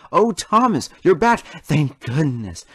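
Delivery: high, loud joyful greeting; excited relief. A narrator's reading. Rhombus greets Thomas, loud and overjoyed. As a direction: cry out in relief.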